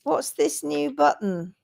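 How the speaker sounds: ordinary delivery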